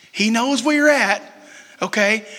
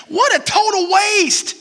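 slow, higher mock grumble at the waste; grumbling disciples; 8 semitones higher, slower than usual. Disciples scoff at the woman's costly oil, mock grumble. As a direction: sneer it like a sulky disciple. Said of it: "they grumbled".

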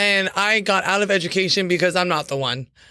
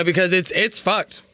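usual speaking voice

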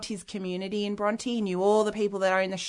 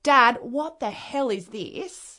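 higher, slow, exasperated demand; retold confrontation; 4 semitones higher, 4 dB louder, slower than usual. Daughter confronts her dad over the wall writings, exasperated. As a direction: act out confronting a parent in disbelief.

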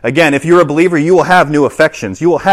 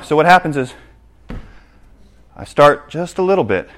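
usual speaking voice

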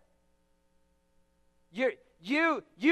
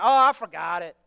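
mock denial; 8 semitones lower, 4 dB louder than usual. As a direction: lower dismissive claim. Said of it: "we don't wanna admit".

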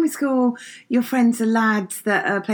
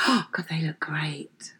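slow, hushed mock-admiring whisper; awed whisper; 7 semitones lower, 9 dB quieter, slower than usual. Interviewer imagining awe at people in suits, awed whisper. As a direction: whisper it slowly, as if struck with admiration.

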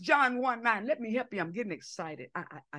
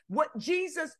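ordinary talk, nothing marked

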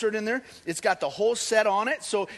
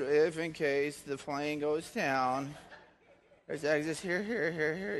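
slow, droning safety speech, softer and lower; monotone flight attendant; 5 semitones lower, 5 dB quieter, slower than usual. Retold or imitated. Pastor mocks the bored safety announcement in slow monotone. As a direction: drone the exits like a bored attendant. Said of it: "they just repeat monotone, boring".

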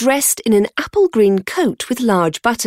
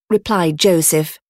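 plain reading voice

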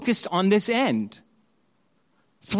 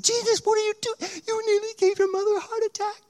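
very high, fast, squeaky mock hysteria; hammed-up parental hysteria; frantic scolding parent; faster than usual. Parents scold the found child.

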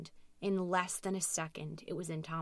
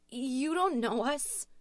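higher, louder, drawn-out frightened cry; nervous shout; 7 semitones higher, 5 dB louder, slower than usual. A narrator's reading. Louise answering the dark figure, shouting nervously. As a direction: shout it slowly with rising nerves. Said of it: "Louise shouted nervously, her voice beginning to shake".

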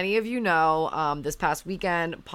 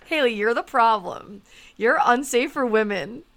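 mock scolding; 5 semitones higher, 5 dB louder than usual.

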